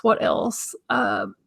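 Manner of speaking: whispered to herself; whispering. Real, not acted. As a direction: mutter it under breath. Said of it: "whispered repeat".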